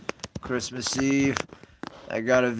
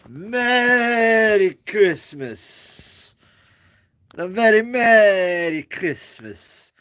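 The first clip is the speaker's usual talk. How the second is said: big, slow, higher drawn-out Merry Christmas; louder, slow, higher sing-song holiday greeting; Mister Hooper greeting; 10 semitones higher, 7 dB louder, much slower than usual. Retold or imitated. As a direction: stretch every word like a kindly TV shopkeeper. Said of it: "As Mister Hooper used to say it, uh, with that emphasis".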